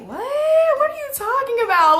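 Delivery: very high, quick acted disbelief; incredulous retort. Arguing back at friend's insult, high-pitched incredulous reply. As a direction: squeal the question in mock shock. Acts own baffled reply.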